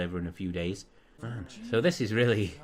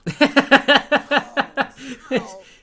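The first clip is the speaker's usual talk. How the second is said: loud cackle; 11 dB louder than usual. Real, not acted. Sims antics cracking him up.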